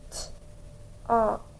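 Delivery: "t, op" broken into separate sounds with a pause; sounding out. Shown as a demonstration. Teacher encoding the next syllable, sounding out. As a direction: isolate the first sound in "t, op", then the rest. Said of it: "sound it out".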